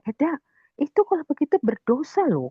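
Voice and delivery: high-pitched re-enacted warning; admonishing. Teller warns in-law about sin, admonishing. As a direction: warn her earnestly, voice lifted high.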